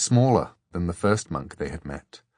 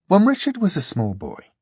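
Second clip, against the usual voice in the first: usual speaking voice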